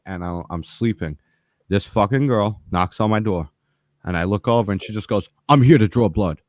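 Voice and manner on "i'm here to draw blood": blunt, curt. Retold or imitated. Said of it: "Just like that".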